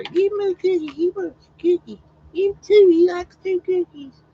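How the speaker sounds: very high, squeaky pleading dog voice; voicing the dog. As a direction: beg for cookies like a greedy little dog. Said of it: "'Cause I know that's what he's gonna say".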